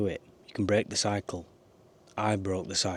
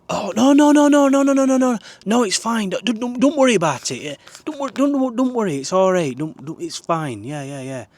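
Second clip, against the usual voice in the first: much higher and louder, gushing panicked reassurance; flustered guy backing down; 14 semitones higher, 11 dB louder than usual. Retold or imitated. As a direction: play the suddenly scared tough guy saying no no no. And he went.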